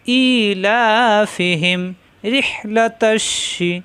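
slow chanted ইলাফিহিম with every vowel drawn out; Quranic recitation. Shown as a demonstration. Teacher modelling the verse for students, slow chant. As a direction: recite ইলাফিহিম slowly and evenly.

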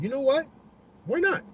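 high, breezy why not; shrugging why not. Retold or imitated. Talking himself into taking the order, high and breezy. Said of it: "I'm like".

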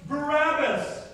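loud, drawn-out demand, pitched high; crowd crying out. Acted. Crowd answering Pilate's question, crying out. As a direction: cry it out slowly, like a whole crowd. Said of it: "the crowd cried out".

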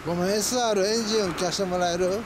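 ordinary talk

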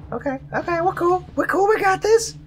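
high anxious self-reassurance; high nervous. Real, not acted. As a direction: talk yourself calm, nervously.

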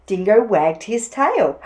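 plain reading voice

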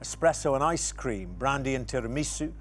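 ordinary talk, nothing marked

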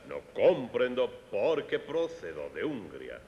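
put-on Spanish with a Hungarian accent, first syllables stressed. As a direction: Hungarian accent, stress every word up front. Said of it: "se identifica a un húngaro que habla en otro idioma".

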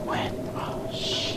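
hushed nervous whisper. Real, not acted. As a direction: whisper it under your breath. Dark, afraid.

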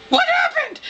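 very high, mock-panicked squeal; comic high-pitched alarm; imitated freak-out. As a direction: mimic a friend shrieking the question. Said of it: "And Dan freaked out".

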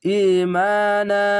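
ইমানা stretched very slowly; slow recitation. Shown as a demonstration. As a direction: draw out ইমানা. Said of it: "এক আলিফ টেনে পড়বেন". (ইমানা টেনে পড়া)

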